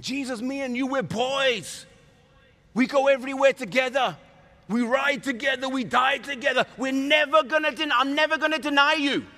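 high-pitched, loud loyal pleading; hammed-up fervent vow; passionate conviction. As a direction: protest undying loyalty to a best friend. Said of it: "with conviction, with passion".

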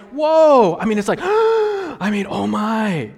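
mock shock. Preacher miming older members' alarm, mock gasp and loud. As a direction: act out the old folks' shock with a gasp.

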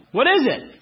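usual speaking voice

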